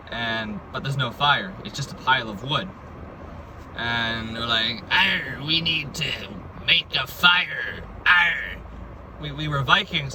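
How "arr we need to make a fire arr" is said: slow, hammed-up pirate voice; mock pirate talk. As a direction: drag the words out like a stage pirate. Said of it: "we spoke like pirates".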